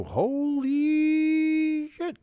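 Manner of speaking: long, drawn-out high holy shit; stunned. Real, not acted. Streamer stunned by the two-point try, slow and high. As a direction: let it stretch out in disbelief.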